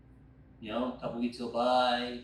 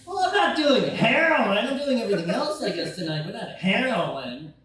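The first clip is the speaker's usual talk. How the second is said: high, loud protest through a laugh; indignant self-quote; 6 semitones higher, 7 dB louder than usual. Retold or imitated. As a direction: protest you're not on heroin, laughing. Replying to paramedic's question.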